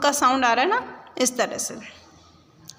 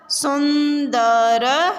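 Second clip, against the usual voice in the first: सुंदर drawn out very slowly and louder, with a rise at the end; slow syllable reading; 2 semitones higher, 5 dB louder, much slower than usual. Shown as a demonstration. Teacher sounds out सुंदर for children, slowly and loudly. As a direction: stretch out सुंदर for the class to follow. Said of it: "शब्दों को पढ़ेंगे".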